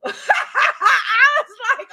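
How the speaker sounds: high, loud laugh while speaking; laughing shout. Real, not acted. Retelling her reaction at the rally, laughing loudly. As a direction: burst out laughing on the words. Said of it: "laugh shout".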